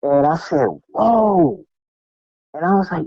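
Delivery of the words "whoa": higher, drawn-out whoa of awe; amazed whoa. Retold or imitated. Seeing the guy hit the floor, awed whoa. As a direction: replay the awe at the fight. Retelling his reaction to knockout.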